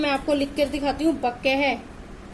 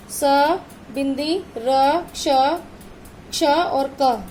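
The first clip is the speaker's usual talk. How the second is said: slow spelling out; slower than usual. Shown as a demonstration. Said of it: "तो आप ऐसे लिखोगे".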